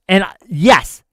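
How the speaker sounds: normal talking voice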